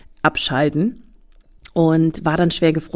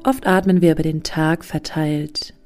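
guided meditation; slower than usual. Acted. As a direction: guide them into calm, slowly. (ruhige Meditationsstimme)

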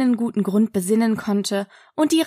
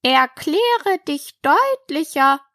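slow, higher, stern command; 7 semitones higher, slower than usual. A narrator's reading. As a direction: demand it syllable by syllable. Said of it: "sagte die Raupe strenge".

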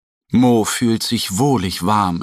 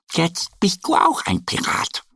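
higher pleased remark; satisfied duck; 8 semitones higher than usual. Acted. Annie approves Mo's costume, higher pleased voice. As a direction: nod it off happily.

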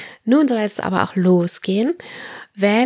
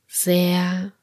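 guided meditation; slower than usual. Acted. Leads autogenic training relaxation.

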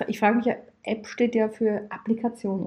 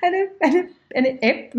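laughing; 8 semitones higher than usual. Real, not acted. Laughing while imagining the app.

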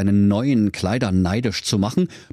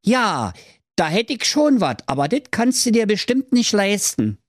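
cheeky; 11 semitones higher than usual. Acted. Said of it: "sprach keck".

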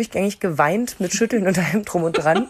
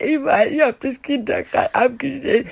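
higher, choked-up sobbing words; imitating sobbing speech; 6 semitones higher than usual. Crying mother answering the nurse, choked sobbing voice. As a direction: stammer it out through tears. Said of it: "hab ich so furchtbar geweint".

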